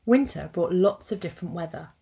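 usual speaking voice

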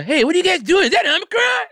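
high, squeaky imitation of a pizza man; high mock-innocent pizza man. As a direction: squeak it like a nosy delivery guy. Pizza man voiced in a story.

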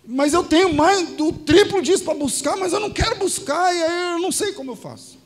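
imitating an anguished plea, high and loud; retold desperate outburst. Drug dealer begging his pastor for guidance, loud acted desperation. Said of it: "e eu tô desesperado".

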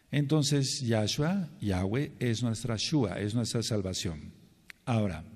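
ordinary talk

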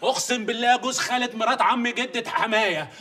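ordinary talk

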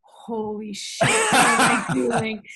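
slow, drawn-out, hammed-up wail; mock tearful panic. Retelling her first-night realization, mock tearful panic. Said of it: "I just started crying".

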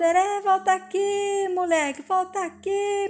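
high calling voice, pitched well up; calling after him. A narrator's reading. Father running after the boy, calling him back high.

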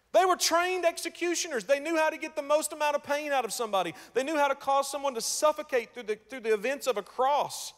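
high, loud, driving sermon voice; full-force preaching. Acted. Preacher describes the crucifixion at full force. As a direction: hammer home the cruelty of the cross.

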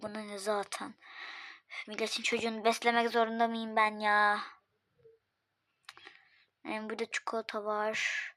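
hushed, half-whispered muttering; grumbling under her breath while checking the food; muttering to herself. Acted. Host grumbles under her breath while checking food, hushed.

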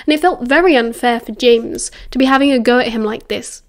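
usual speaking voice

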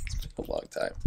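unvoiced breathy whisper to himself. Real, not acted. Reactor whispering to himself about old movies, hushed. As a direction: murmur it under your breath like an aside.

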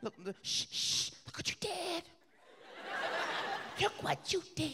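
high, soft hissed hushing; scolding wife hush. Retold or imitated. Wife hushing husband over woken kid, high soft scold. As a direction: hush him sharply.

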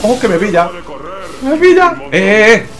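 high loud panic; panicked yelling. Real, not acted. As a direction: yell as it catches you. Being chased by robot.

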